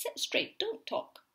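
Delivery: sharp strict teacher, jumping high. Acted. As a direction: bark it like a stern schoolteacher. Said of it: "telling the class".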